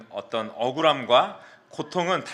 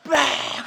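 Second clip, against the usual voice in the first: loud, high, drawn-out whack noise; imitated smack; 12 semitones higher, 5 dB louder, much slower than usual. Storyteller imitating coach's slap on his head, explosive smack noise. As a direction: mimic the blow with an explosive cry. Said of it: "하고 때리는 거예요".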